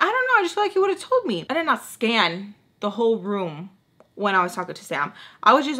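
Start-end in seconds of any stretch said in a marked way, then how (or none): 0.0-1.5 very high, rushed squeaky reply; flustered defence. Retold or imitated. Questioned woman explaining her hunch, high flustered squeak. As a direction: pitch it way up, a mock helpless excuse.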